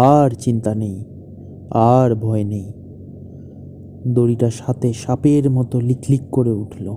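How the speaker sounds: low, measured story reading; story narration. Acted. Writer reading his tragic story to his wife, solemn narration. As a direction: read it low and solemn, letting each phrase settle.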